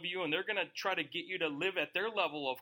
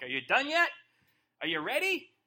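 loud, impatient nudge; are you finished yet, said louder; impatient call; 3 semitones higher, 6 dB louder than usual. Retold or imitated. Minister waiting on wayward people.

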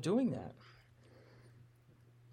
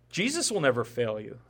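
ordinary talk, nothing marked

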